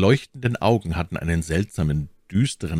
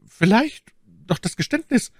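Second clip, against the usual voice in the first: high, anxious lady's voice; alarmed exclamation; 14 semitones higher than usual. A narrator's reading. My Lady demands D'Artagnan's confession, alarmed. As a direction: press him, pitched high with alarm. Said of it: "rief sie erblassend".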